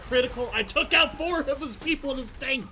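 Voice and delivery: high, loud burst of disbelief; real high-pitched excited yell; excited shouting. Real, not acted. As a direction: shout in amazement at a huge lucky hit. Streamer reacts to big hit in game.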